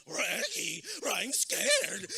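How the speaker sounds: loud, scared cartoon dog, leaping high; Scooby-Doo. Comedian flying head first like a cartoon dog, scared yelping dog voice. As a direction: yelp it like a terrified cartoon dog, trailing into a wail. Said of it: "You know when Scooby's flying like".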